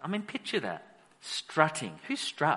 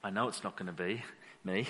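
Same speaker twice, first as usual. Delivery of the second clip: smug mutter; 8 semitones lower, 8 dB quieter than usual. Retold or imitated. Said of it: "Some of you would be thinking".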